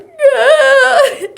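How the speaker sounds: high, loud wailing yeah; mock crying yeah. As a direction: cry it out, hammed up. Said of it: "Nimi cries like".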